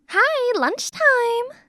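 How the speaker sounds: coy, playful greeting; high flirty voice. Acted. Jane seductive at door.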